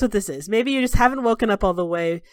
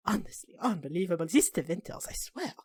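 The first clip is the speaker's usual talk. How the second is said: softer, grumbled under her breath; muttered grumble; 2 semitones lower, 10 dB quieter than usual. Acted. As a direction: grumble to yourself on the way out. Servant grumbling to herself leaving.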